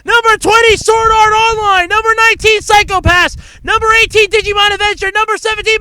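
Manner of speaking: rapid-fire countdown, high and loud, shouting the big titles; racetrack announcer. Real, not acted. Podcast host racing through the top twenty like a racetrack announcer. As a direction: call each title like the final lap of a horse race. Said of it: "I felt like a announcer at a racetrack".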